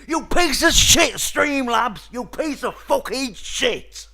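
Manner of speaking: very loud, high raging yell; furious shout. Real, not acted. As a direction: scream the insults out. Explodes at Streamlabs again.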